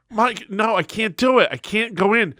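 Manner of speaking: high-pitched pleading refusal; exasperated protest. Retold or imitated. Employee refusing his boss on the phone, high exasperated protest.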